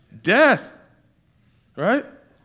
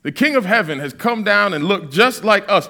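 ordinary delivery

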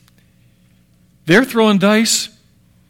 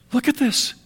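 awed hush; 7 dB quieter than usual. Acted. Disciples' amazed quiet realization.

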